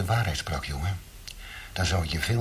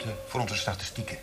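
ordinary talk, nothing marked

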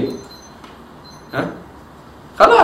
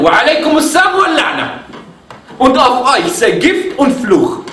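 retold angry retort; 8 semitones higher than usual. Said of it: "ist wütend geworden".

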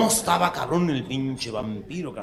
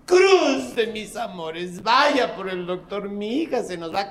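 melodramatic plea; 7 semitones higher, slower than usual. Acted. Melodramatic mother pleads.

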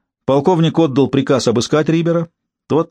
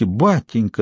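ordinary delivery, nothing marked

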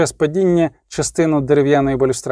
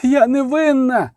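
high, drawn-out wailed protest; wailing; 8 semitones higher, slower than usual. A narrator's reading. Accused sister pleading with her brothers, wailing. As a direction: wail your innocence, acted. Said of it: "завила Аделя фон Стерча".

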